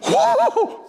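high delighted whooping; spy whooping at giant grapes, thrilled; excited whoops. Retold or imitated. Spies marvel at giant grapes.

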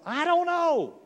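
very high, loud exclamation; high-pitched loud protest; baffled outburst. Acted. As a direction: throw it out in comic bewilderment. Acted baffled answer to a question.

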